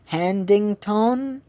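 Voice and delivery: "Handington" slowly, ending in a long "tone", rising; syllable slightly off. Retold or imitated. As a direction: imitate an unsure reader saying "Handington" as "Handing-tone?". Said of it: "tends to be a little bit off".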